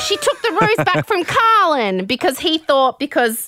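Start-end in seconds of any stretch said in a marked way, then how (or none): 0.1-2.0 high-pitched breaking-news report; mock alarmed tattling. Tim running back to the boys, mock alarm. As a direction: imitate a man blurting out gossip. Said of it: "and he's like".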